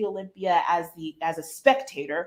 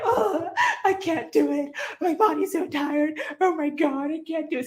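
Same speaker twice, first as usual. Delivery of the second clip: mock crying; 8 semitones higher than usual. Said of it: "crying my eyes out".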